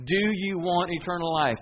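normal talking voice

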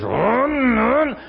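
high, drawn-out engine roar; vocal sound effect of a straining motor; imitated engine revving. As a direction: mimic an RV engine being gunned. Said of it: "He guns it another time or two and hears".

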